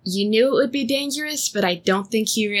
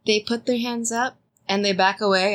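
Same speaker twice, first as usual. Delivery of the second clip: usual speaking voice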